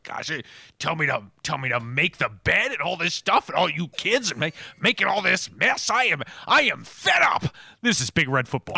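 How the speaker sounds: exasperated husband venting at home; escalating to a shout at the end; fed-up husband voice. Pretend. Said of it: "that's his tag out".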